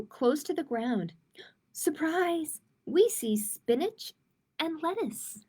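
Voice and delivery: put-on gentle storybook read-aloud for kids; reading to children. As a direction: read it like storytime for little ones. Reading a picture book aloud.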